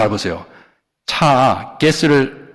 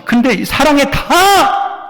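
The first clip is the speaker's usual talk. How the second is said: high, slow, shouted emphasis; preacher's slow rising shout; emphatic shout; 13 semitones higher, 4 dB louder, slower than usual. Acted. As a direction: hammer home 사랑 with a drawn-out cry.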